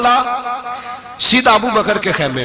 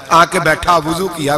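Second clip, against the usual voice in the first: ordinary delivery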